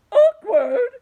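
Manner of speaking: very loud, high outraged shriek. Acted. The earthworm shrieks at the grasshopper, loud and outraged. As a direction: shriek back in disbelief. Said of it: "cried the earthworm".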